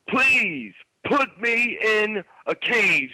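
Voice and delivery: high, very slow begging promo voice; hammed-up wrestler pleading; Ric Flair rant. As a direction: stretch every word like a wild wrestler. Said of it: "And then he says".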